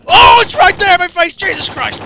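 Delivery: high, loud frightened yell; shrieking in alarm; panicked scream. Real, not acted. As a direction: scream as it jumps in your face. Enemy right in his face.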